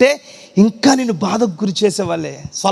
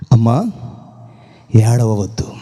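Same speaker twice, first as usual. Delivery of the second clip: tender comfort; 12 semitones lower than usual. Acted. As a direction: hush her tears gently. Jesus comforting grieving mother.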